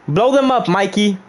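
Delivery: high, emphatic order; insistent command. Acted. Streamer orders Mikey to blow them up, high and insistent. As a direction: pitch it up and push the order. Repeats order with more force.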